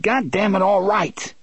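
high-pitched exasperated outburst; crying out. A narrator's reading. Eddie agreeing against his will, exasperated cry. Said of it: "Eddie cried".

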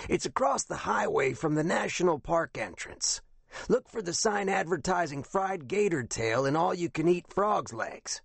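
high-pitched scratchy captain giving directions. A narrator's reading. Retired captain directs the investigator to the restaurant, scratchy voice. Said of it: "in a voice that sounded even scratchier".

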